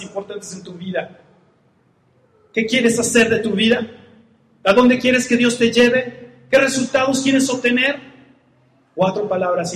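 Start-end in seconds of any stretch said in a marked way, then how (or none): 2.5-8.0 higher, louder urgent questions; climbing preacher's challenge; preaching at full force. Acted. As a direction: press the congregation with each question. Rising exhortation.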